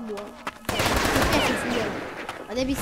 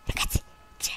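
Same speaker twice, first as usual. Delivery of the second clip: hushed whisper to the viewers; 9 dB quieter, faster than usual. Real, not acted. Gamer to viewers mid-match, whispering. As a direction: whisper it under your breath.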